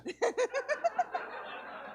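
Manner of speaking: high, breathy burst of laughter at his own joke. Real, not acted. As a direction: crack up at the punchline.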